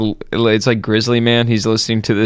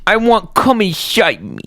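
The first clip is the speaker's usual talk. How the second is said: put-on high pouty little-girl voice; whiny baby voice; 8 semitones higher than usual. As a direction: stamp your feet and whine it like a toddler. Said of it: "she stomps her feet and she says".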